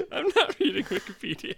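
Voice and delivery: high laughing protest. Real, not acted. Denies while laughing.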